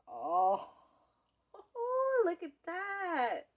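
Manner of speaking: high, slow, delighted laugh; excited. Real, not acted. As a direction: gush over it with laughter. Sees the cheese pull.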